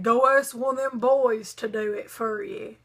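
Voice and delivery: lightly voiced elderly teacher, offhand; dismissive old teacher. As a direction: toss it off like a lazy old man. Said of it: "So he said".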